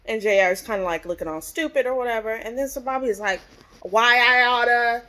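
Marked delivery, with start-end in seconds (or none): 3.8-5.0 louder, slow, drawn-out comic threat; mock threat. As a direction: play at being about to hit someone. Acts out Bobby threatening J R.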